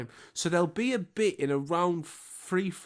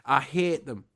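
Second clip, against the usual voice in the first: ordinary talk, nothing marked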